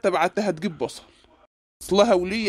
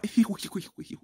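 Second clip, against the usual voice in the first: shocked laugh; 5 dB quieter than usual. Acted. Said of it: "خليتك تضحك بدل ما تعيط".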